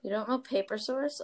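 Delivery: usual speaking voice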